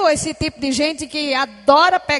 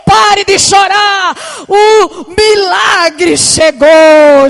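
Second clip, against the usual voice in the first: loud, higher, slow proclaiming shout; preaching at full force; 3 semitones higher, 10 dB louder, much slower than usual. Acted. Preacher crying out to the weeping, loud and triumphant. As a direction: thunder the command over the crowd.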